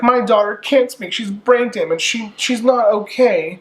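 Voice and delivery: high-pitched, flustered mom pleading; a mother insisting her daughter can't talk; distressed mother. Retold or imitated. Mother protecting brain-damaged daughter.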